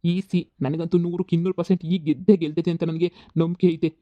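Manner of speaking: ordinary talk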